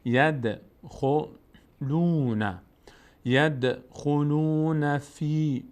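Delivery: ید with the d bouncing, drawn out slowly; slow chant, echoing the d in ید; qalqala on dal. Shown as a demonstration. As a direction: let the dal of ید rattle. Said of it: "باید مقداری در مخرجش ... بلرزه".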